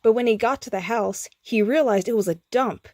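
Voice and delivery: ordinary delivery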